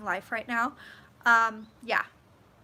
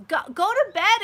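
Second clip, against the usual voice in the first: 1 loud urging; 9 semitones higher, 8 dB louder than usual. Real, not acted. Urging late viewers to sleep.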